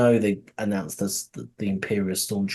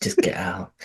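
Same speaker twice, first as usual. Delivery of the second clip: whispered; 3 semitones lower than usual. Retold or imitated. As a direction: whisper it under your breath. Whispered echo of quote.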